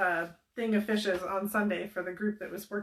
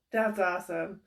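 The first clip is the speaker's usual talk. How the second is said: ordinary talk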